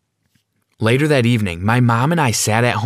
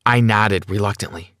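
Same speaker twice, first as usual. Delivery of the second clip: ordinary delivery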